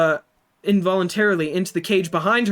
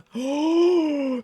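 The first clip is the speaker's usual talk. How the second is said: excited gasp; 8 semitones higher than usual. Real, not acted. Entering the stables excitedly.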